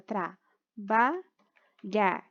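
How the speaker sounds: trabalhar very slowly as tra, ba, lhar; long pauses between the three syllables of trabalhar. Shown as a demonstration. Teacher dictating for the child, trabalhar in slow isolated syllables. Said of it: "coloca lá pra criança".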